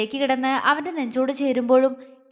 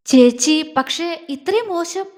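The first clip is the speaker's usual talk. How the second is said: loud, shocked cry of the name, then higher disbelief; stunned question; 4 semitones higher, 6 dB louder, slower than usual. Acted. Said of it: "അവൾ അമ്പരപ്പോടെ ചോദിച്ചു".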